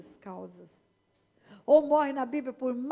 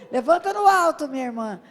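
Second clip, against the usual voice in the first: laughing call; 11 dB louder than usual. Real, not acted. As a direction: cheer her on with a laugh. Urging a woman to raise hand.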